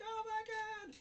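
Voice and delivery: high, loud imitated shriek of fright; mock terror. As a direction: shriek as if seeing a scary face. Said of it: "she sees a super scary face".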